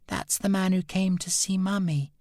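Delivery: put-on high, small frightened boy voice; shaky child. A narrator's reading. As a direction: speak up like a scared little boy. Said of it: "Ernest said shakily".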